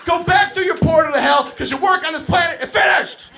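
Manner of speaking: high, full-force hammed-up shouting; furious exorcist yell. Coach banishes the devil kid back to hell, high roaring shout. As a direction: roar it like banishing a demon.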